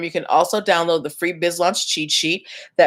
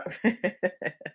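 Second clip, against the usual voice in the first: soft laugh; 11 dB quieter than usual. Real, not acted. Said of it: "I'm just being silly".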